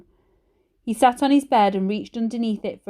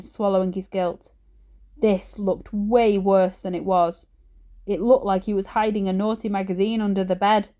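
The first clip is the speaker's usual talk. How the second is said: usual speaking voice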